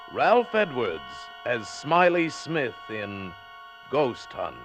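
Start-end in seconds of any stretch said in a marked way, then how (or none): none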